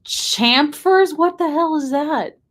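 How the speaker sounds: high, loud disbelief; baffled outburst. Real, not acted. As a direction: blurt it out in confusion at a strange word. Baffled by an unknown word.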